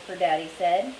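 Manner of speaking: ordinary delivery, nothing marked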